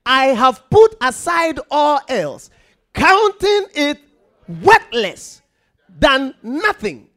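high, loud preaching read; loud scripture proclamation. Acted. Preacher to congregation, loud proclamation. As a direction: declare it to the church.

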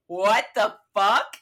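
loud, drawn-out mock disbelief; retold outrage. Traveller reacting to a cancelled flight, retold outrage. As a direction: re-enact losing it, slow and loud. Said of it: "I lost my motherfucking mind".

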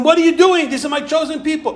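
high, indignant scolding; God rebuking Bilam, indignant; mock rebuke.

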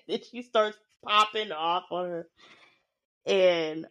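ordinary talk, nothing marked

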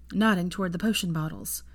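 ordinary delivery

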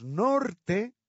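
"norte" lento y fuerte con la e final; correct norte. Shown as a demonstration. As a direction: marca la e de "norte" despacio. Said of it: "no nort". ("norte" pausado y enfático)

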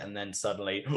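normal talking voice

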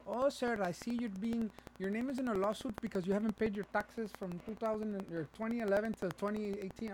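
high, put-on official spiel; scam caller impression. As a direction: recite charges as a phony agent. Acts out the scam woman.

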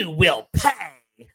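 slow, menacing villain line; slow and sinister cartoon villain; Disney villain voice. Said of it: "giving me Disney villain with every line".

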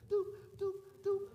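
high, steady checkout beep; high-pitched electronic blips by mouth; imitated scanner beeps. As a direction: mimic a register scanner, "doop, doop". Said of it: "I need you to do this".

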